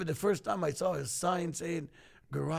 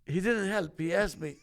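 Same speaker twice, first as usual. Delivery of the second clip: ordinary delivery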